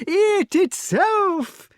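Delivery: very high, stretched squeal; delighted king. A narrator's reading. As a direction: draw it out in high glee.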